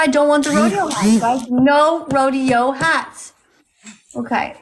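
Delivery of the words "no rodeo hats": very slow, every word pressed; emphatic rule. Real, not acted. Host warning viewers off rodeo hats, very slow emphasis.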